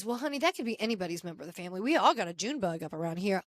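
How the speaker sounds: a quick, hushed imitation of Cynthia teasing; sassy southern aunt. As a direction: toss it off like a southern auntie. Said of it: "Cynthia says".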